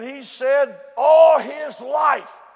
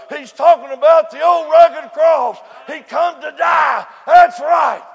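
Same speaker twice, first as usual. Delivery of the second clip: loud, rising preacher cadence, hitting key words; preaching at full force; 4 semitones higher, 9 dB louder than usual. Acted. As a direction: whoop it up to the congregation. Preaching on Calvary.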